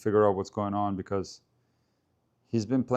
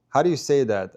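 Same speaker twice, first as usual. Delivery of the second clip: ordinary talk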